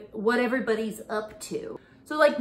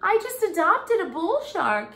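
much higher, louder protective wail; a pretend worried shark mom; hammed-up high-pitched alarm; mock panic; 9 semitones higher, 3 dB louder than usual. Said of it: "I'm like".

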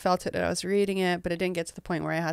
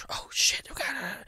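whispered alarm; hushed, flustered staff voice; panicked whisper; 6 semitones higher, 3 dB quieter than usual. Retold or imitated. Staff whisper among themselves, imitated in a panicked whisper. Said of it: "they just like".

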